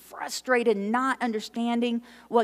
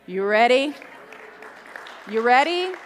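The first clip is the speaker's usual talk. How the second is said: louder, rising each time, pumping up the room; rallying call; 7 semitones higher, 8 dB louder than usual. Real, not acted. Preacher to congregation, rousing rising calls. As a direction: build the excitement with every ask.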